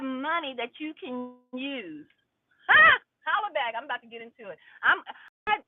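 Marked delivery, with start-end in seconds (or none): 2.7-3.0 high, loud burst of a laugh; a quick loud laughing bark; sharp loud ha. Real, not acted. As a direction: blurt a sudden high ha. Sudden loud exclamation.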